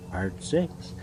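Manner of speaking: ordinary delivery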